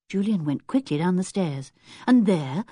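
ordinary delivery, nothing marked

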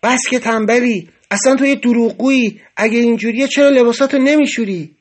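angry outburst at a cellmate. A narrator's reading. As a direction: accuse him of lying and laziness. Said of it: "با عصبانیت بلند شده گفت".

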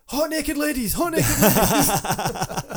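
drawn-out, laughing sing-song; laughing mock ad voice. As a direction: ham up a cheesy advert line. Mocking ad voice, laughing.

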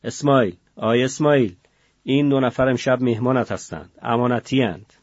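loud call down the cell, اسماعیل with a falling end; guard shouts to the cell boss about new prisoners; shouting. Acted. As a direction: yell for Esmail across the room. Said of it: "فریاد زد".

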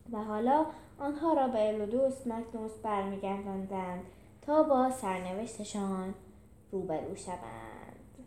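usual speaking voice

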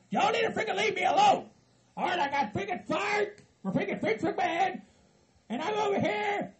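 high-pitched, aggrieved protest; angry complaint. Acted. Demands to be left alone.

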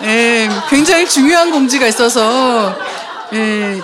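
laughing announcement. Real, not acted. Host announcing a correction about the book, laughing. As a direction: keep announcing through the laughter.

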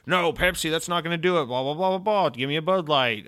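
fussy customer. Retold or imitated. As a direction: brush off the Pepsi like a snob. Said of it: "And it's like".

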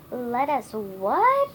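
loud, slow, incredulous question; demanding. A narrator's reading. Marigold challenges Wizard's offer, demanding. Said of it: "Marigold demanded".

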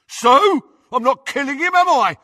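high, loud defiant retort; yell. A narrator's reading. Crabbe throws off Malfoy's arm, defiant yell. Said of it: "yelled Crabbe".

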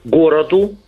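normal talking voice

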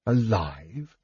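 soft, puzzled rise; repeated to himself. A narrator's reading. Dragon questions the order to himself, softly. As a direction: mutter it back in disbelief. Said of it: "the dragon repeated to himself".

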